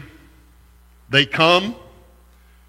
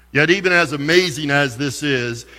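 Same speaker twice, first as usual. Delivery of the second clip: usual speaking voice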